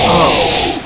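loud sudden whoop; overexcited outburst mid-speech; excited yelp. Real, not acted. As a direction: shout it out in excitement. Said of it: "I just get really excited".

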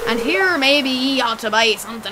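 put-on old pirate spinning a yarn; pirate sailor voice; pirate accent. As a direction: talk like a salty pirate fisherman. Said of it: "give him a pirate accent now".

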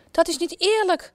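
high, indignant outburst of an angry queen; queen protesting angrily, a high indignant cry. A narrator's reading. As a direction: cry it out furious that it's unfair. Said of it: "roept de koningin boos".

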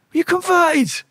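high-pitched, incredulous question. Retold or imitated. Prisoner confronting a man he knows, incredulous. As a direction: throw it at them in disbelief. Confronts acquaintance who has converted.

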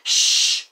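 mom's whispered shush. Retold or imitated. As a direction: imitate mom hushing you. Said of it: "then she's like".

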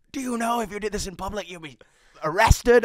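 mock-outraged, climbing to a loud peak; outraged teacher. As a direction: imitate an exasperated teacher telling you off. Angry teacher's warning, retold.

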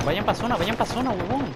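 urgent shout. Real, not acted. Urging team to the zone.

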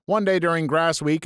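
normal talking voice

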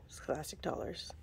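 whispering the words on the certificate. Real, not acted. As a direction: read the label under your breath. Reads the label off the certificate.

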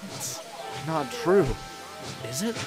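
hesitant, high-pitched hurt; upset. A narrator's reading. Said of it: "he felt very upset".

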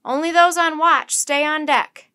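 high, full-volume order to the crew; very loud, commanding shout; captain's shout. A narrator's reading. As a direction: bellow it across the deck. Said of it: "the captain shouted to his crew".

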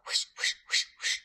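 breathy whoosh, whoosh of a wagging tail; whooshing tail sound. Acted. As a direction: push air out like a swishing tail.

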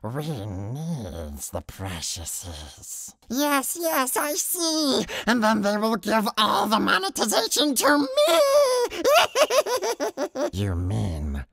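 Gollum arguing with himself. Acted. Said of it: "It'll sound something like this".

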